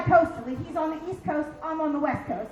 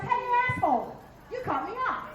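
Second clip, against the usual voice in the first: mock road rage; 7 semitones higher than usual. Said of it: "when I was thirty and driving".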